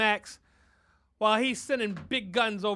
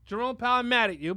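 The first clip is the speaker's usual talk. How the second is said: usual speaking voice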